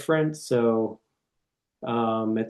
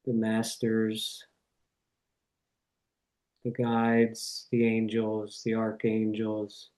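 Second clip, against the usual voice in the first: reverent prayer; 2 semitones lower, 4 dB quieter than usual. Real, not acted. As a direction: pray unhurriedly, phrase by phrase, settling into a hush. Leads an opening prayer.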